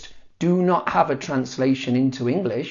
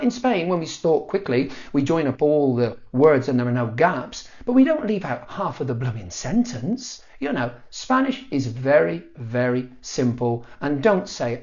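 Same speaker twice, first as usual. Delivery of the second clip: normal talking voice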